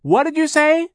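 very high, loud question; shouting. A narrator's reading. Charles cups his ear over machinery, shouting. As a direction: holler as if unable to hear. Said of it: "he shouted".

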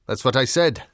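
loud, impatient dog; gruff and insistent. Acted. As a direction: insist loudly, as the gruff ticket checker.

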